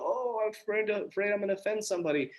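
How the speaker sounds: mock timid poster. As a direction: mimic a timid poster fretting about offending. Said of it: "put one up and go".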